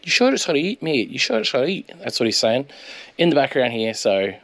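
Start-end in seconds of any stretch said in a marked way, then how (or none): 0.0-1.9 high mock-anxious quoting; imitating a worried mate. Narrator mimics mate fretting in background, high and anxious. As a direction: lightly play the fretting mate. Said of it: "so he's like".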